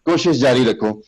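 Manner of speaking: ordinary delivery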